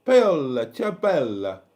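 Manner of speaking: hammed-up Italian accent, loud on the name; amateur Italian accent with falling tune; best Italian accent. Shown as a demonstration. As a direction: call it out in a mock Italian accent. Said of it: "best Italian accent".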